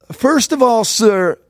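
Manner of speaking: high, admonishing Southern drawl; mock Southern accent. Southern clerk to fast-talking customer, mock drawl. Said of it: "You're in the South now".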